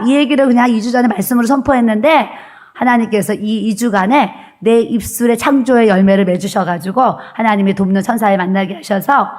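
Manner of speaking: normal talking voice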